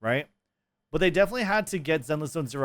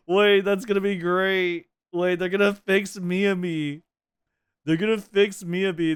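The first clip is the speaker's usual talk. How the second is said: excited; 5 semitones higher, 4 dB louder, slower than usual. Real, not acted. Excited reaction to the meme fix.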